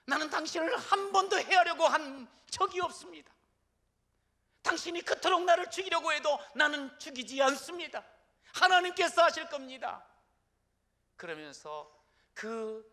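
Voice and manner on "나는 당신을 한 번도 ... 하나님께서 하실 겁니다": loud, high call across the distance; preacher voicing David's loud cry. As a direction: shout it after the departing king. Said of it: "큰 소리로 소리를 지르면서".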